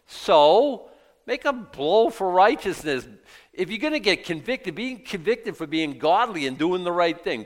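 loud, high exhortation; rally the congregation; fiery preaching. Acted. Preaching at full force.

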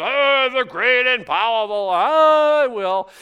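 loud, high, slow booming wizard. Acted. Preacher mocking God as Oz, booming wizard voice. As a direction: boom like the great and powerful Oz. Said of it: "like the Wizard of Oz".

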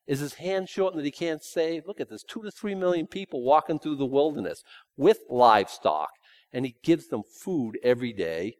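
ordinary talk, nothing marked

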